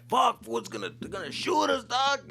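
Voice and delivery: high, loud panicked yelp; paranoid panic. Retold or imitated. Teen in truck bed panicking about a drive-by, high and loud. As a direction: freak out that they're about to get shot. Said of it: "getting all paranoid".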